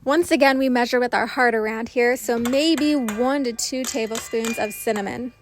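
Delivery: loud upbeat recipe narration; voiceover. Acted. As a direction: read it like a cooking show host. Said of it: "switching over to voiceover".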